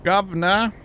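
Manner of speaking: high, loud mock British accent; hammed-up British call with a rising end. Gamer calling out to tease Yuleberry, mock British accent. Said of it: "with my terrible British accent".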